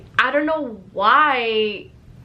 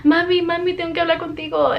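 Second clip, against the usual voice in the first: high, hurried imitation of crying to mom; mock tearful plea; 6 semitones higher, faster than usual. Crying kid begging to talk to mom, imitated tearful plea. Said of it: "I was crying and I was like".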